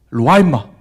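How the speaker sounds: put-on calling the boy over with a sharp shout; stern shout. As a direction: bark it out loud like a stern mentor. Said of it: "한마디만 했습니다". (큰 소리로 단호하게 부르기)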